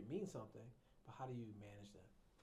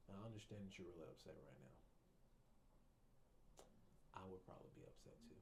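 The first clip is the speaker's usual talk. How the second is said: lower, softer, gentle acknowledgment; calm, soothing voice; 4 semitones lower, 13 dB quieter than usual. Shown as a demonstration. Therapist calms an angry patient with a soft voice. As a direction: ease them down, soft and low. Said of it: "lower the pitch ... slow down the cadence".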